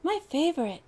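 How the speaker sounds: high and eager; a happy howl over the cake; lightly voiced eager dog; excited howl. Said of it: "Paris howled".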